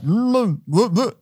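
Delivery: high muffled hums through closed lips; muffled mm with bee in mouth. Retold or imitated. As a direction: hum in alarm, mouth shut. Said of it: "And I'm like".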